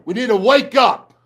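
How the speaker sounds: real fired-up rallying shout. Real, not acted. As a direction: yell it at the team. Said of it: "I get fired up".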